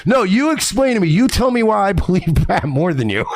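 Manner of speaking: high, demanding "No, you explain"; indignant demand. Retold or imitated. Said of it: "the way you said this killed me".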